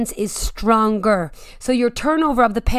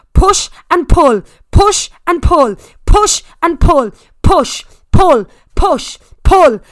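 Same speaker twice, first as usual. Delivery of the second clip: loud, high coach shouting; gym bro; 3 semitones higher, 6 dB louder than usual. Real, not acted. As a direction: drive them up the climb. Spin coach pushing climb.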